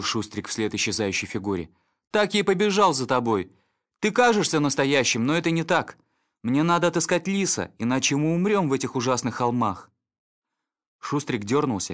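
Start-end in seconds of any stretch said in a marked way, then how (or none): 2.1-9.9 high, loud, unsettled dog; agitated dog voice. Acted. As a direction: talk back to a phantom, raised. Snitter speaks to vision.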